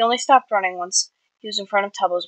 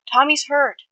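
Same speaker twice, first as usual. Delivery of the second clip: high, loud alarmed cry; panicked shout; 7 semitones higher, 5 dB louder, slower than usual. A narrator's reading. Calling for help in panic.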